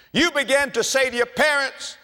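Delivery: ordinary delivery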